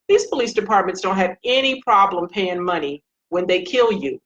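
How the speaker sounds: ordinary talk, nothing marked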